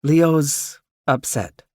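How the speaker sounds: high, very slow motherly voice. Acted. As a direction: mom breaking bad news. Mother's grave news.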